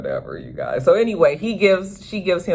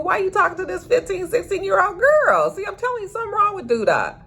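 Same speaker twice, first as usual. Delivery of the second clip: pitched way up in disbelief; shocked and appalled, fast at the end; incredulous. Real, not acted. Vlogger outraged at Duda chasing a teen girl, squeaky disbelief. Vlogger reacting to Duda's creepiness.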